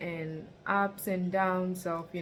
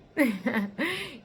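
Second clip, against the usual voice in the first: short rueful laugh; 7 semitones higher, 6 dB louder than usual. Real, not acted. Rueful laugh about depression.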